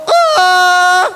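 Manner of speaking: long, high puppet cry; hammed-up sob; mock wail. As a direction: pretend to burst into a wail. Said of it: "Terus kamu nangis".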